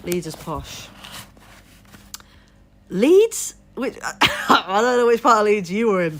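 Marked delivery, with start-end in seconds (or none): 2.9-3.5 loud, high rising disbelief; incredulous question. Real, not acted. Reacting to being told Leeds is posh, incredulous. 3.7-6.2 incredulous laughing. Real, not acted. Teasing a viewer about which Leeds they mean, incredulous laughing.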